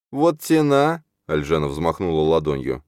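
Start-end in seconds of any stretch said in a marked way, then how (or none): 0.1-1.0 high, louder mock exclamation; playful high-voiced huff; joking grumble. A narrator's reading. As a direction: grumble in jest, voice pitched up. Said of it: "буркнула шутливо".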